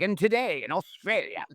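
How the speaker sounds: put-on high-pitched old-time English broadcaster; pretend English accent. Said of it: "pretending to sound English".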